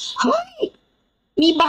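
very high cry, held back in volume; imitated shouting. Imitating boat people who spot Auntie Bai, high mock shout. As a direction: mimic an alarmed crowd's shout. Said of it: "เขาตะโกนแบบนี้".

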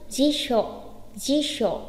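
"Di sộ" said as jisho; correct pronunciation. Shown as a demonstration. Teacher repeating for learners, "Di sộ" as jisho. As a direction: say "Di sộ" with a hushing sh. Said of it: "Nhớ đọc chuẩn phát âm".

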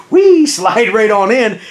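a mock gleeful whee as if sliding into the seat, drawn out; playful whee. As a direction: act out the easy slide with a playful squeal.

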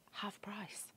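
conspiratorial whisper, no voice at all; whispered aside. Acted. Presenter whispering the bargain price to viewers. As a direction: let the viewers in on a secret.

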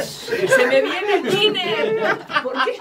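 high, slow, laughing wail; slow laughing cry of disbelief; laughing dismay. Retold or imitated. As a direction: stretch it out through laughter as the thinner hits. Thinner sprays back at her.

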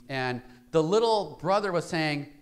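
normal talking voice

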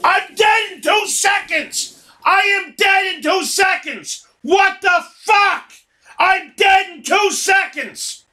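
real exasperated gamer shouting; angry yelling. Real, not acted. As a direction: yell it at the screen, fed up. Dying instantly in the game.

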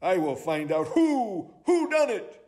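high sleuth rising to a hoot; high-pitched owl resolve; determined owl detective. Acted. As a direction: vow it, ending in a high hoot.

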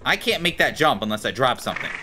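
usual speaking voice

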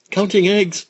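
squeaky proud answer; high little alligator; high child voice. Acted.

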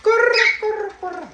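very high, loud drawn-out call; parrot-style calling. Real, not acted. Owner prompting the parrot to talk, high loud squawky call.

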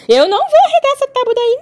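very high, laughing protest to the dog; high laughing refusal. Real, not acted.

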